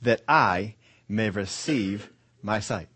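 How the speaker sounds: slow, with weight on "I" and "receive"; deliberate phrasing. Shown as a demonstration. Preacher savoring the blind man's wording, "I" and "receive" drawn out. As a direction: spread it out, pausing before "my sight". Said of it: "I love the way he phrases this".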